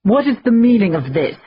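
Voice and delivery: loud, imperious demand from Blind Michael. Acted. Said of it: "demanded Blind Michael".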